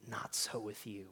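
breathy whispered echo. Shown as a demonstration. Preacher repeating Jesus' words to the church, in a whisper. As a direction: drop to a hushed whisper to land it. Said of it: "repeated Not so with you, whispered".

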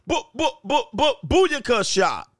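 high, popping gunshot noises; playful high-pitched gunfire sound effects; imitated gunfire. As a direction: fire off mock gunshots, drawing out the last.

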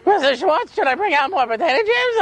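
put-on high-pitched, overeager kid helper voice; Myron. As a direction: call out like a hammed-up, too-helpful boy.

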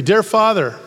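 fervent prayer opening. Acted. Opens group prayer.

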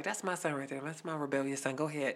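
hushed proud-parent aside; proud murmur. Retold or imitated. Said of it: "I was like".

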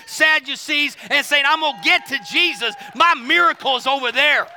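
fervent sermon storytelling at full voice; preaching. Real, not acted. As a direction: preach it hard, hammering the stressed words.

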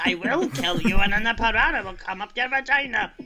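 put-on very thick Aberdonian accent. Saying what Luke said as an Aberdonian, thick mock accent. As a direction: ham up a broad Aberdonian accent. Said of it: "in a very Aberdonian accent".

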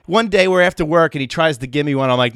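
ordinary talk, nothing marked

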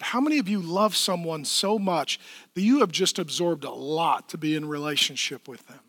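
ordinary talk, nothing marked

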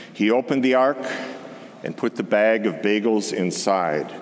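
usual speaking voice

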